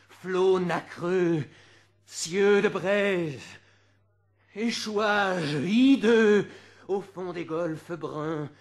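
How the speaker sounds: slow, loud and high, each image weighted; slow grand declamation. Acted. Reciter to audience, slow loud grand listing. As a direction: lay out each image like a painting.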